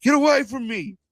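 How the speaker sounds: high, sharp imitation of a brushed-off star; mock brush-off. Guy on the plane brushing off fans, high mock voice. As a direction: toss it off in a high mocking voice. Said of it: "what did he say".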